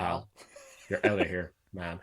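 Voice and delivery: full stop after "pal", then "man" set apart; reading the punctuation. Shown as a demonstration. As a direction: chop it at each written stop, isolating "pal". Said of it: "do the punctuation in places where it actually had it".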